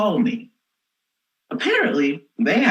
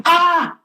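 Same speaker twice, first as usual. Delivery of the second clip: panicked yelp; 11 semitones higher, 4 dB louder than usual. Retold or imitated. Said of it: "So I'm like".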